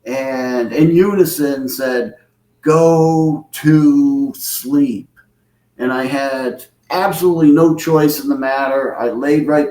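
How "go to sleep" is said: very slow, spaced-out words; drawn-out command. Retold or imitated. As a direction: intone each word slowly as a command. Retold command said in unison.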